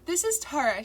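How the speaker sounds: polite office receptionist. A narrator's reading. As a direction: professional phone greeting. Receptionist voice continues.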